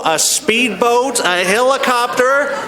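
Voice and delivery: high, drawn-out exasperated God voice with a laugh. Acted. God answering the drowned man, high and exasperated. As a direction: throw up your hands as God, stretching each rescue.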